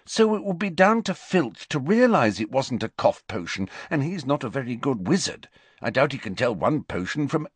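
lightly voiced know-it-all; lecturing Hermione. A narrator's reading. Voices Hermione arguing, breaks off.

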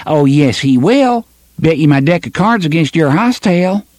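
put-on high, sure-of-himself boy; cocky boy betting. First boy daring his friend to bet, high and cocky. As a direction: push the bet with a grin.

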